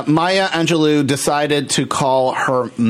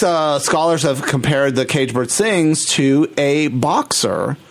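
normal talking voice

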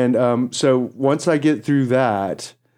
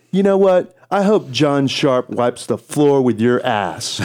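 defiant jab; 3 semitones higher, slower than usual. Retold or imitated. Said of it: "and I went".

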